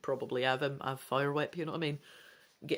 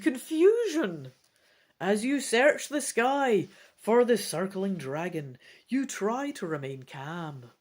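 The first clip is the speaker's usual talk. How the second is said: lively, raised storybook narration; dramatic story narration; 4 semitones higher, 4 dB louder than usual. Acted. Narrating the dragon attack, dramatic. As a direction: read the scene with drama.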